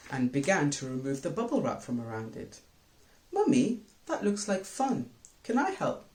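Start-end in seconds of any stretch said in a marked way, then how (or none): 3.3-6.0 put-on a child begging to join in; eager and much higher. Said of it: "Muhammad eagerly asked".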